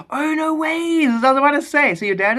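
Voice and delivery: high, loud astonished exclamation; delighted surprise. Real, not acted. Streamer hears viewer shares their story, astonished. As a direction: burst out high in amazement.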